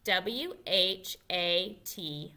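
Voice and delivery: slow letter by letter W, H, A, T; spelling along. Shown as a demonstration. Teacher spelling along slowly with children. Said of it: "Can you spell it with me?".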